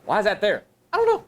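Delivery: lightly voiced puzzled kid; mock kid whine; imitating kid. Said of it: "Kid go".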